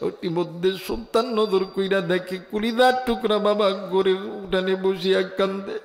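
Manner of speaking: normal talking voice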